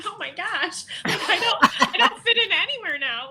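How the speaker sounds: high and laughing, words breaking into giggles; laughing exasperation. Real, not acted. Speaker laughing at not fitting in anywhere, high laughing voice. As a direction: laugh it out, a bit lost. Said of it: "And I'm like".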